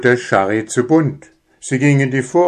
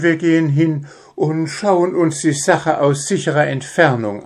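much higher cautious mother's voice; timid hare mother; 7 semitones higher than usual. Acted. Hare mother plans safe distance, timid. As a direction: reason it out nervously.

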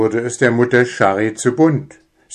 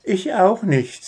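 much higher timid mother; hare mother; 11 semitones higher than usual. Acted. Hare mother shares the doubt, timid. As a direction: agree nervously.

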